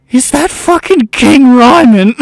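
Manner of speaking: loud, slow disbelief; incredulous shout. Acted. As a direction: bellow it in disbelief. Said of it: "one of them says".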